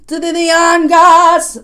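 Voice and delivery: loud, high, slow imitation of the singer's squall; imitating the singer. Copying the singer's squall for the viewers, loud and high. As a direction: belt it out with a thick squall like him. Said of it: "He's put the emphasis on they".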